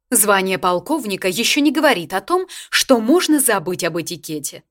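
loud, imperious lecture of manners; grande dame. A narrator's reading. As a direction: put the colonel in his place regally.

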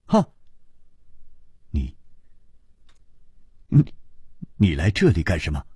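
startled, halting half-finished start; blurted in shock. A narrator's reading. Hero confronts the princess out of bed, blurting in shock. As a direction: stammer it out, then drop low and hurried. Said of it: "他失声说".

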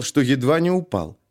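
ordinary delivery, nothing marked

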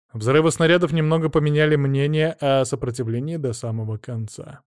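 plain reading voice

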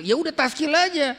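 high, loud and curt, as if waving it off; brisk dismissive reply. Acted. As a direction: snap back a loud high "just do your taskil". Dai menjawab murid dalam adegan. (jawaban tegas yang meremehkan, nada tinggi dan keras)

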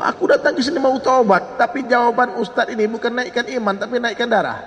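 high-pitched, aggrieved protest; worked-up complaint. Retold or imitated. Angry repentant thug complaining about the cleric, high and aggrieved. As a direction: retell a hot-headed man's indignant grievance. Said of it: "Emosi lagi nih anak muda".

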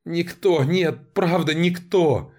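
high, desperate cry of denial; desperate pleading. A narrator's reading. Captive begs torturer, a desperate high cry. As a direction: beg him to stop, pitched high.

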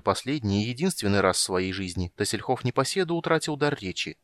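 plain reading voice

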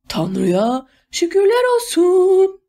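high, slow, drawn-out warble; singsong trill. A narrator's reading. Baroness gives thanks from her doorway, trilling it out high and slow. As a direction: sing it out high, stretching the vowels. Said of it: "diye şakıdı".

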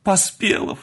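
high, astonished cry of greeting; high-pitched excited reunion cries; joyful exclamation. A narrator's reading. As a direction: call out each name in delighted surprise. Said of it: "воскликнули они враз".

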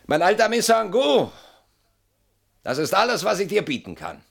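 anguished cry. Acted. Anguished cry to old friend.

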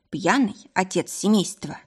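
plain reading voice

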